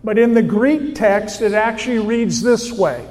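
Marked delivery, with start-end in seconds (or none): none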